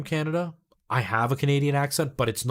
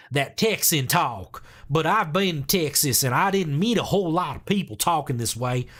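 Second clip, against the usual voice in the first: Texan accent; 2 semitones higher than usual. Shown as a demonstration. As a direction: slip into a mock Texas twang. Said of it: "like the Southern American accent".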